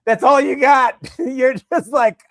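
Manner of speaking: high, loud laughing disbelief; cracking up mid-sentence; squealing with laughter. Real, not acted. Laughing at caught husband.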